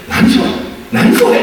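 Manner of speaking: baffled and amused; puzzled laugh. Retold or imitated. Vater wundert sich über Bitte.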